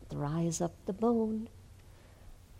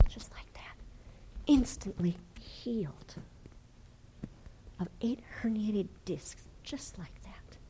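a soft whisper of wonder; awed whisper; 5 dB quieter than usual. Acted. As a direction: drop to a hushed whisper. Preacher whispers the miracle.

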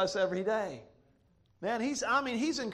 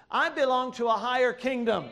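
emphatic, raised preaching voice; forceful preaching; 3 semitones higher, 4 dB louder than usual. Real, not acted. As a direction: build a driving list, stretching each phrase for the congregation. Preaches at rising force to congregation.

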